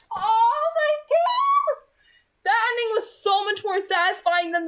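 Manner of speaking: real gushing excitement; excited. Real, not acted. Reader reacts to finishing chapter.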